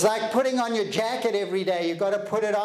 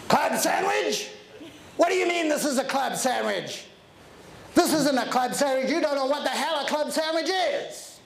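higher, indignant mock outrage; obnoxious rude passenger; 5 semitones higher than usual. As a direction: sneer at the sandwich like a rude passenger. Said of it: "just obnoxious and rude".

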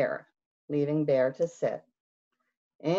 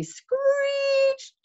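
much higher, very slow screech; screeching words; 4 dB louder than usual. Acted. Narrator painting Hare's sudden stop, high and slow. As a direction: draw the words out like brakes.

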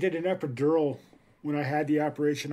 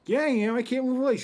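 whiny mock insistence; 8 semitones higher than usual. Insisting to wife.

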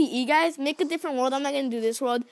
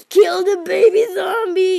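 urgent shout; 4 semitones higher, 8 dB louder, slower than usual. Real, not acted. Urgent command during fight.

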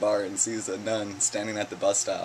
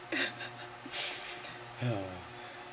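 breathy silent laugh; 7 dB quieter than usual. Real, not acted. Laughs after punchline.

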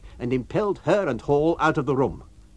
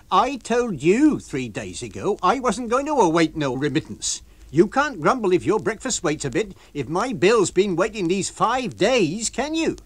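Mrs Hall, fierce landlady; 7 semitones higher, 5 dB louder than usual. Acted. Landlady answering the lodger's complaint. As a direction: throw his question back at him.

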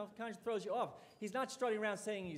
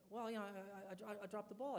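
imitating a sheepish, stammering athlete, much softer; 3 semitones higher, 8 dB quieter than usual. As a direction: own up quietly, tripping over the words. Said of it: "looks at me a little sheepishly".

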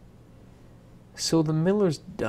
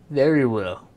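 firm resolve; 12 dB louder than usual. Acted. Girl makes deal.

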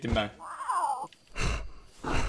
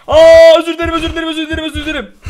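frantic apology; 13 semitones higher, 14 dB louder, faster than usual. Real, not acted. Gamer apologizing frantically to animal.

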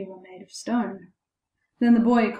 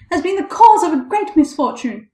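high, loud outraged complaint; crying out loudly; 5 semitones higher, 6 dB louder than usual. A narrator's reading. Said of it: "with loud screams".

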